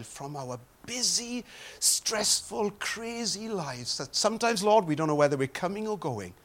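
soft, earnest spoken prayer. Real, not acted. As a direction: pray quietly and sincerely. Leads congregation in prayer.

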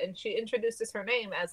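usual speaking voice

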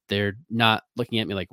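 ordinary delivery

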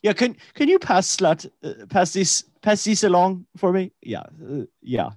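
put-on German Irish accent. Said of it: "a little bit of Irish mix".

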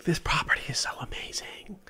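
hushed whisper. Retold or imitated. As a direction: whisper in awe. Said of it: "and I'm like".

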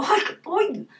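high-pitched exasperated grumble; imitated annoyance. Mother cross with her children but not yelling, mock annoyance. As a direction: bite back a scolding in exclamations. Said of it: "cuando estoy enojada".

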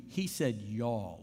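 y'all drawn out slow and low; slow stress on y'all. Shown as a demonstration. Pastor contrasting you with y'all, slow. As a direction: linger on y'all. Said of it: "he didn't say you".

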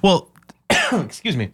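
normal talking voice

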